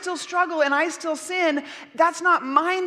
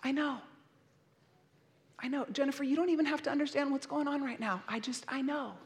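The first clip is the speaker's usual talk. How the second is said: God's tender comfort; 9 dB quieter than usual. Pretend. God holding Jennifer, soft tender reassurance. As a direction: hold her close and hush her. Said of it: "He just holds me and he says".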